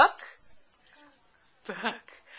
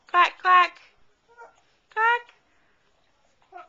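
put-on high, loud playful quack for a toddler; a bright, high sing-song quack; duck quacking noise; 10 semitones higher, 8 dB louder, much slower than usual. Shown as a demonstration. Mother models duck noise for toddler.

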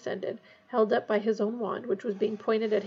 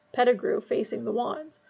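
ordinary delivery, nothing marked

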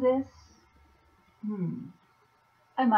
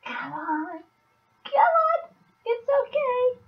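high, loud sing-song encouragement; bright, much louder coaxing come on; coaxing cheer; 5 dB louder than usual. Retold or imitated. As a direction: cheer them on like coaxing a pet. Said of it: "So I'm saying in response to that".

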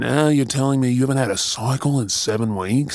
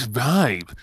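laughing breathlessly between the words; joyful laughing disbelief; overjoyed laughter; 2 semitones higher than usual. Acted. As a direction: burst into giddy laughs. Learning they are having a baby.

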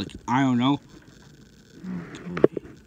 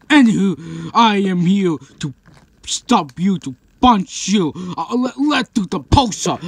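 raised, excited monster challenge; play-fight challenger; 4 semitones higher, 5 dB louder than usual. Pretend. One monster threatening to punch another, loudly challenging. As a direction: throw down a loud mock challenge.

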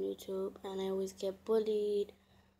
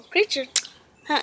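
high, loud disbelieving "Creature?"; shocked question; 4 semitones higher, 11 dB louder than usual. Real, not acted. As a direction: blurt it out in disbelief. Reacting to "a creature" claim.

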